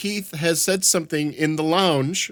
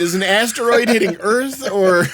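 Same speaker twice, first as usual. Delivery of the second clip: mock shock; 6 semitones higher, 4 dB louder than usual. Retold shock at Keith's post.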